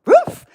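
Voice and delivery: high, loud yelped bark; mock dog bark. Mocking dog barks at victim.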